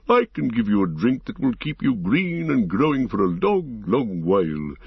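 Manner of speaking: ancient tree-creature making a measured offer; stately forest-giant voice; Treebeard, hospitable. Acted. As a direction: roll the words out generously and unhurriedly.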